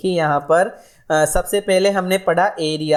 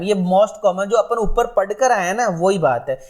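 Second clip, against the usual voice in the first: ordinary delivery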